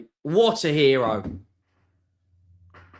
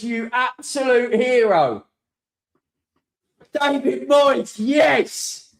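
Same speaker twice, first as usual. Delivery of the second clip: elated praise; 8 semitones higher, slower than usual. Real, not acted. Fan hailing the manager to his chat, elated and drawn out. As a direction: drag out the name in adoration.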